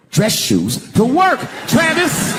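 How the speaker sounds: slow, loud, high call-out; mock scolding shout. Calling out his bodyguard Travis, slow loud mock yell. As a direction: drag it out and shout at him.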